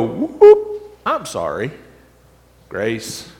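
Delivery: a breezy "whoop, whoop" then a dismissive shrug; flippant laugh-off. Retold or imitated. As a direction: brush it off lightly like it's nothing. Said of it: "we can laugh it off".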